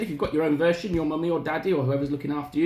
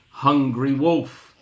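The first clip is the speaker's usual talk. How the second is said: ordinary delivery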